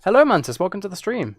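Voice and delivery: ordinary talk, nothing marked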